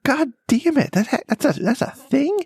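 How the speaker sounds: high-pitched retold yelp of disbelief; shocked outburst. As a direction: squeal it in mock disgusted shock. Retelling shock at the earwax.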